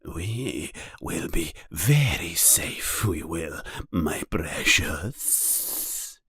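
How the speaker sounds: low hissing whisper to himself; hissed to himself. A narrator's reading. Gollum hissing to himself about his precious. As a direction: hiss it privately, slow and secretive. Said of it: "he hissed to himself".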